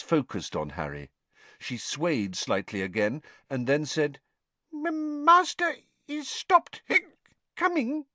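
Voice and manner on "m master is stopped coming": high, tipsy house elf; a wobbly, squeaky little elf. A narrator's reading. Drunk house elf Winky speaking.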